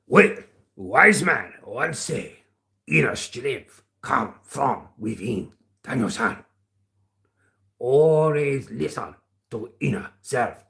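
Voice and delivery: slow mock-Japanese wise master; measured, halting Japanese-accented proverb. Performing at a viewer's request, slow Japanese accent. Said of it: "Can you do a Japanese accent?".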